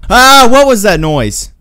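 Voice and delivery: high, loud cry of alarm; yelp, then drag out the question; startled yell. Real, not acted. Player hearing a sudden noise, startled yell.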